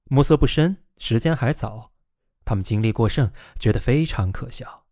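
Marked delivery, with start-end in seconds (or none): none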